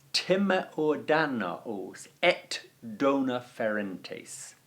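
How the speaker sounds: slow, deliberate Latin quote; slow Latin recitation. Shown as a demonstration. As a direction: read the line out slowly, word by word. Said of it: "he says in Latin".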